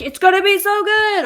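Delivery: high, loud mock-hype squeal; gushing excitement. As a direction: gush like an overexcited fan. Acting out an eager reaction.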